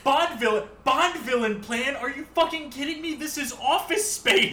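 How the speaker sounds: high, loud mock outrage; incredulous. Real, not acted. Friend loudly scoffing at the Bond villain comparison.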